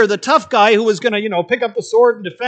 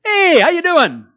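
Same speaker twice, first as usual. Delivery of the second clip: bright higher casual hey; casual greeting; 8 semitones higher, 4 dB louder than usual. Retold or imitated. Preacher imitating a casual everyday greeting, breezy. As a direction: toss it off like greeting a buddy. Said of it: "Kind of like".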